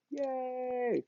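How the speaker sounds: high, soft puppet cheer; a little squeaky yay for the sheep. Pretend. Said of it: "let's give an applause to Mister Sheep".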